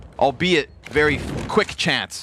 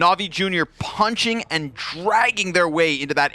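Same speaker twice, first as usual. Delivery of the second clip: dramatic caster with punched, stretched key words; live play-by-play; 6 semitones higher than usual. Real, not acted. Caster dwells on the dropped op shot, dramatic play-by-play. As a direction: hammer home the missed chance.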